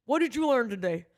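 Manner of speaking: a hint of a lecturing dad; lightly voiced Southern father; imitated dad. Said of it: "how she imitates his voice".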